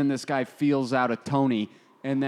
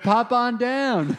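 slow, louder, higher mock of "pop on down"; mocking chiropractor; 7 semitones higher, 4 dB louder, much slower than usual. Friend mocking the chiropractor's line, slow and high. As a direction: drawl out "Pop on down" in a teasing voice.

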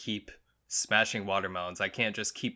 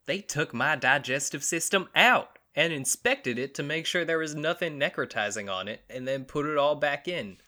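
higher-pitched mock Florida accent; put-on Florida man accent; 5 semitones higher than usual. Host redoing Florida man's quote, mock Florida accent. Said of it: "I didn't do it in the accent".

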